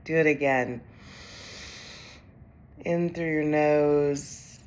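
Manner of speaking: calm, unhurried meditation voice with long pauses; guided meditation. Acted. Executive coach leads overwhelmed leaders through breathing, calm guided meditation. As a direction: guide them gently through each breath.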